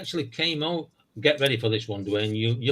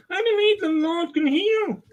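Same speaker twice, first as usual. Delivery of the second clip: mock voice of a man with a speech impediment. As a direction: squeak it out like the guy who talks funny. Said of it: "I said".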